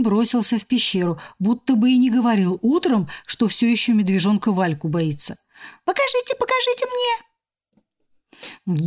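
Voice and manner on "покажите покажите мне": squeaky cockroach voice. A narrator's reading. Cockroach eagerly asking.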